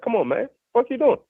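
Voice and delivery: high-pitched mock reprimand, not panicked; imitated scolding dad. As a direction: imitate a father getting on his son's case. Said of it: "sound like he just getting in his ass".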